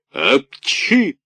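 high, loud sneeze burst; loud theatrical sneeze. A narrator's reading. Karabas sneezing while scolding, loud sneeze. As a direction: let the sneeze explode.

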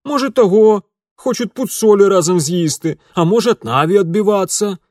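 high, loud mocking cook; shrill defiant woman.

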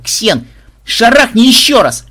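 high loud, slow eager cry; delighted yell. Acted. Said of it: "в полном восторге завопила". (восторженный крик)

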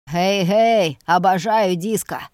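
creaky old man, hammed up. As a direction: croak it like an old geezer. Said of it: "Мира изобразила скрипучий голос старика".

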